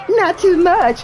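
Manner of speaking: put-on high little-kid voice; little niece voice. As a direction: pipe it up like a three-year-old. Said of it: "So she gonna say".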